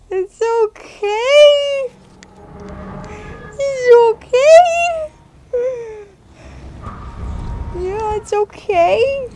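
reassuring coo. Real, not acted. Owner soothing the scared dog, slow high coo. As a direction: comfort a frightened pup.

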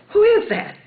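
high, loud retold demand; startled question. Teller asking the voice from the light, startled and loud.

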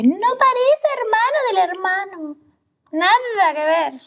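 very high, stretched-out mock teacher voice; maestra asombrada. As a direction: mimic a teacher, drawing out each judgement up high. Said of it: "Si hasta la maestra me dice". (imitación muy aguda de maestra, alargando las palabras)

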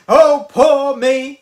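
slow, loud, wailing oh poor me; mock self-pity. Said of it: "he goes to have a little pity party".

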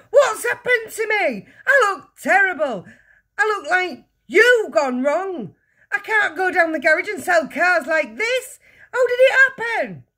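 high, loud, horrified wail of a yell. A narrator's reading. Father staring into the mirror, yelling. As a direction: howl in dismay at full pitch. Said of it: "yelled the father".

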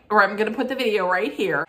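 ordinary talk, nothing marked

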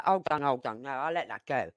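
soft, meek climb-down; letting it go. Acted. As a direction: back off softly, voice falling away. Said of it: "I wasn't gonna sit there and say".

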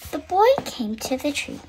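usual speaking voice

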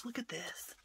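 whisper. Real, not acted. Whispered awe.

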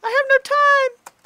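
high, loud mock panic. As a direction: suddenly realize time ran out. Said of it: "you're like".

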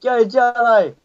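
high excited cheer. Real, not acted. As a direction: cheer him on. Cheering friend on.

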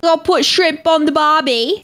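slow, lightly put-on Australian line; Aussie accent. Friend jokes in a mild Australian accent. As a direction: drawl it out like a tourist's Aussie. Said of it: "Chuck on your Aussie accents".

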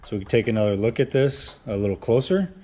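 ordinary talk

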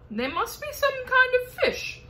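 put-on high, odd voice of an old grasshopper. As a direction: play the grasshopper, high and strange. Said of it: "said the old green grasshopper".

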